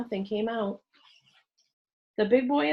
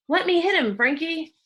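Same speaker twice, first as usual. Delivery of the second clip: loud, higher eager plea; eager to fight; 9 semitones higher, 5 dB louder than usual. A narrator's reading. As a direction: beg to throw the first punch. Eager boy wanting to fight.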